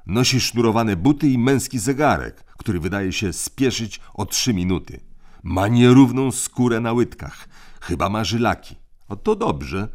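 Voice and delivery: crisp orders from the eldest; bossy older brother. A narrator's reading. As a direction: lay down the rules like a little tyrant. Tim describing the nanny.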